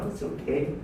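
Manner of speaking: soft, low, forgiving; gentle reassurance. Acted. He smiles at the shopper who hit him, softly reassuring. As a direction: let them off softly.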